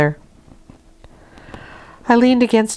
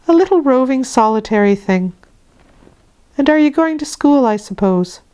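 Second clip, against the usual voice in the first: nursemaid's voice; 7 semitones higher than usual. A narrator's reading. Bessie voiced in story.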